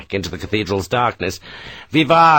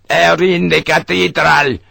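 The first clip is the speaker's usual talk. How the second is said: louder, higher warning cry; shout; 5 semitones higher, 4 dB louder than usual. Acted. Vivar warns Sharp about the enemy, shouting. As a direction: call the alarm across the plaza. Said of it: "he shouted at Sharp".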